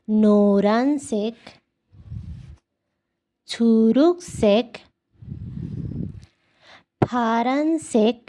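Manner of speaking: each color name slow and deliberate; teacher slowly running through the color list; slow review. Shown as a demonstration. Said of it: "শুরু থেকে আরেকবার বলবো".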